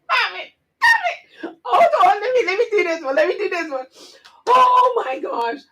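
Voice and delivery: high, squealing words through laughter; crying with laughter. Real, not acted. Scene: vlogger losing it at the name joke, squealing laughter. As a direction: laugh until it hurts. Laughing fit mid-story.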